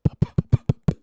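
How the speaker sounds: loud voiceless p bursts into the mic; rapid-fire "puh" pops; popped plosives. Shown as a demonstration. Apologizing for plosives, demonstrating loud mic pops. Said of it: "Sorry for the plosives".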